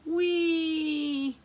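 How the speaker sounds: high playful sledding cry; gleeful whee. A narrator's reading. Teacher voicing kids sledding in the book, gleeful whee. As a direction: call it out like sliding downhill. Sliding down hills in story.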